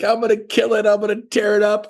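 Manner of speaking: high, eager boast; pumped up, ready to conquer; excited new agent. Retold or imitated. New agent hyping himself up, excited. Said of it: "You're like".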